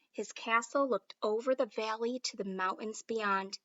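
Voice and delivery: ordinary delivery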